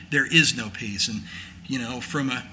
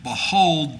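ordinary delivery

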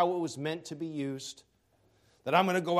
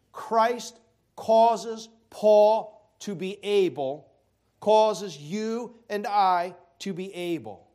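slow emphasis; 5 dB louder, slower than usual. Real, not acted.

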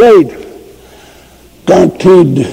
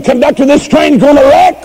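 put-on frantic old woman; 6 semitones higher than usual. Frightened old woman calls conductor.

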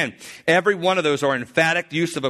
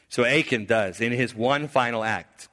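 normal talking voice

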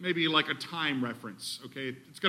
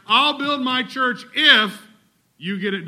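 mock conditional quote; 6 semitones higher, 8 dB louder than usual. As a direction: hammer the IF as the absurd version. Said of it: "Do you hear Jesus saying at all in there".

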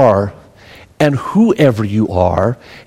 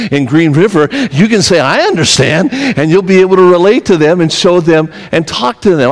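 a touch higher, building preacher's push; rising sermon fervour, pressing the point home; preaching; 6 semitones higher than usual. Acted. Preacher urging the congregation that God shapes their lives, building fervour.

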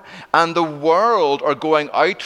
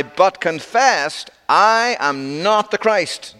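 slow, raised, emphatic denial; 3 semitones higher, much slower than usual. Retold or imitated. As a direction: draw out "I am not the Christ", each word weighted and higher. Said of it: "It's very emphatic".

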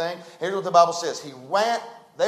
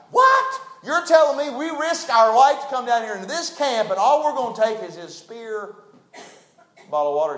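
shocked, high-pitched outburst of disbelief; incredulous Abishai; 5 semitones higher, 3 dB louder than usual. Pretend. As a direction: Abishai can't believe it's only a spear and water. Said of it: "I can see Abishai now".

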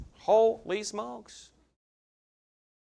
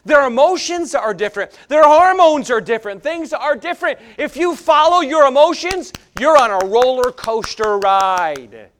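loud, higher, driving sermon; preaching at full force; 6 semitones higher, 9 dB louder than usual. Acted. Preacher warning the church about emotions, preaching at full force. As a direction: hammer the point to the congregation.

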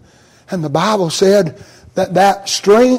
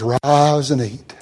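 gentle low command; 7 semitones lower, 7 dB quieter, slower than usual. Acted. Angel wakes the weary Elijah.

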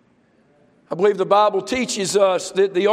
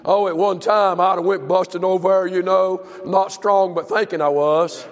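ordinary talk, nothing marked

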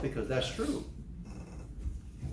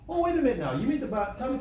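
mock surprise; 8 semitones higher, faster than usual. Mock objection, higher.

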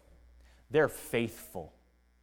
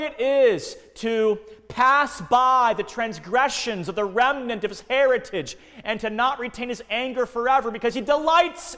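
raised, loud, impassioned preaching; preaching at full force; 8 semitones higher, 6 dB louder than usual. Acted. Preacher exhorting his congregation, full impassioned force. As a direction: lift it up and proclaim God's covenant glory.

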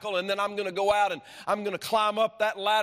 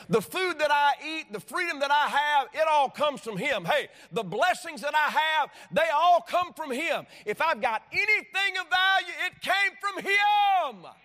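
raised pitch, building to a drawn-out peak; impassioned preaching; 7 semitones higher than usual. Real, not acted. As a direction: preach it with mounting fervour. Preaches at rising force.

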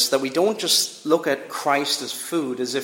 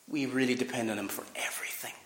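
fast, breathy whisper; 4 semitones lower, 12 dB quieter, much faster than usual. Acted. Preacher whispers to the congregation how much they depend on him. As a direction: drop to a whisper for emphasis.

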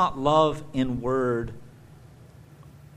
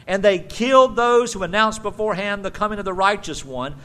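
fiery rebuke; 5 semitones higher, 5 dB louder than usual. Acted. Preacher voicing Stephen's rebuke.

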